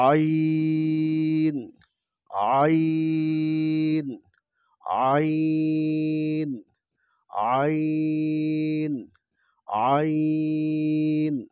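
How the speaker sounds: আইন drawn out slow, thin, from the middle of the throat; thin mid-throat ain. Shown as a demonstration. Teacher demonstrating the ain letter sound. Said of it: "চিকন করে গলার মধ্যখান থেকে আনতে হবে".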